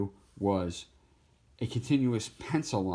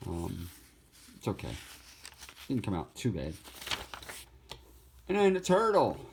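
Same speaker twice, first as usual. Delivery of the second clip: ordinary talk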